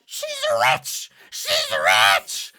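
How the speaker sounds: loud squawked yell; crow yelling. Acted. Crow hanging in the trees, loud yell. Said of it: "yells one of these little, uh, crows".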